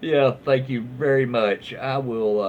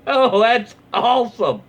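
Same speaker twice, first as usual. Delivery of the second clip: higher, louder delighted exclaim; light up at the comment; real delight; 8 semitones higher, 7 dB louder than usual. Real, not acted. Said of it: "delighted by chat".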